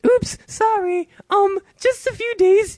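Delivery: put-on very high girlish voice, slow on the small town; girl's voice. Reading the girl's texts to his cohost in a very high girlish voice. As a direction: squeak it out like a teenage girl.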